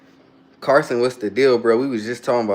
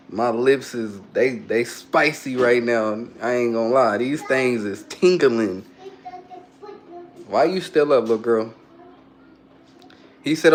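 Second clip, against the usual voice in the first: ordinary delivery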